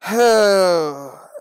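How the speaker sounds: long, loud, high exasperated sigh. Real, not acted. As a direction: heave a big sigh.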